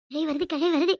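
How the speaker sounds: ordinary talk, nothing marked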